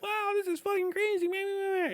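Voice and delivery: high-pitched, goofy awestruck voice; little high schooler. Acted. High schooler entering the military, high naive amazement. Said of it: "be a little high schooler".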